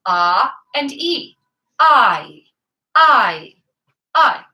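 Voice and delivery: "ah and e" said slowly, then "I" split into ah gliding to e; vowel parts separated. Shown as a demonstration. To singing students, breaking down the diphthong. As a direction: pull "I" apart into ah and e. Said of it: "you get the two different sounds to that vowel".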